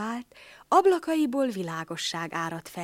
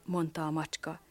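plain reading voice

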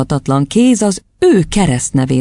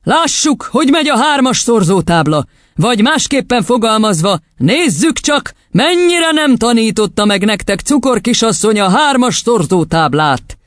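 high, sneering menace; menacing challenge; 8 semitones higher than usual. A narrator's reading. Headmistress testing the class's times table, menacingly. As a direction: challenge them with biting mockery.

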